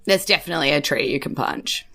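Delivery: ordinary delivery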